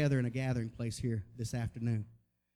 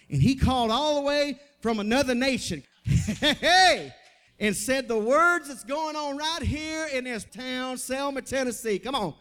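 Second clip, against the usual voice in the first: high, loud, triumphant proclamation; exultant preaching; 9 semitones higher, 5 dB louder than usual. Real, not acted. Preacher telling his church of a call from another nation, exultant. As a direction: ring it out to the whole church. Preacher builds to story's climax.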